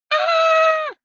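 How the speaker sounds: high shrieking scream of fright; terrified scream. A narrator's reading. Teddy seeing the bone fly at them, screaming in terror. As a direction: scream in panic. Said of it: "yelled Annie".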